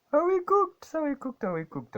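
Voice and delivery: high squealed panic, falling off each repeat; panicked squeal. Real, not acted. Trader squeals at the chart in mock panic, high and loud. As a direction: shriek the question high, then let it sink.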